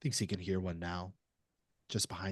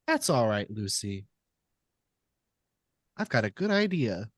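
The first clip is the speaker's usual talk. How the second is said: falsetto creepy puppet; 7 dB louder than usual. Retold or imitated. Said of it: "a sort of falsetto voice".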